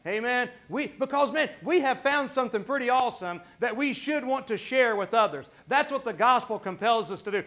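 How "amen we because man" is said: ordinary delivery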